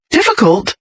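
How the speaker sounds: high-pitched disbelief, falling away; incredulous echo. Acted. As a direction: throw the word back in disbelief. Jessica throws his word back.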